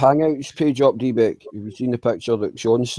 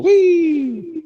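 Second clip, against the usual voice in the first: mock whee. Mock gleeful cheer after joke.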